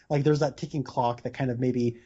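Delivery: ordinary delivery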